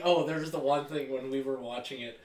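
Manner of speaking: ordinary delivery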